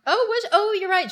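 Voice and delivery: high-pitched surprised agreement; sudden realisation. Real, not acted. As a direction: jump up high as it dawns on you.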